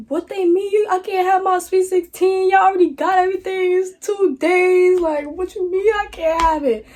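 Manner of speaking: high, upset pleading voice; distraught protest. Retold or imitated.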